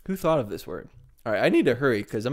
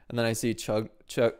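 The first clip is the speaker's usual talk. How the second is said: ordinary talk, nothing marked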